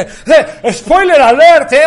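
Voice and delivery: high, loud, drawn-out heckle; mock offended shout. Mocking people offended by spoilers, exaggerated shout. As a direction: yell it mockingly, pitch way up. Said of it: "Me caga que la gente se ofenda".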